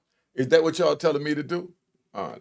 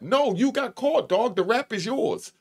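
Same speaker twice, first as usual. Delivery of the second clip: much higher, slow scolding; scolding retort; 8 semitones higher than usual. Real, not acted. Talking to the imagined snitch, a high slow scolding retort. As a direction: set him straight, high and slow.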